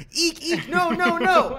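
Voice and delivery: shrill mock squeaks, very high; high squeal. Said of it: "I just go".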